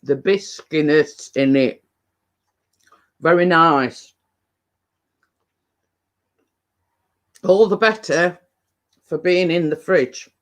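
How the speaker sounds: talking while chewing; words round a mouthful of truffle; mouth full. Real, not acted. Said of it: "Talking with my mouth full again".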